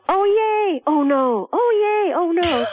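put-on a child's gut feelings swinging between joy and woe; excitable child. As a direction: play a fickle little kid flipping from glee to dismay. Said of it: "is like a child".

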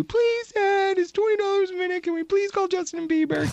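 put-on high, pleading child; begging kid voice. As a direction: whine it like a kid begging dad. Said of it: "they are begging you".

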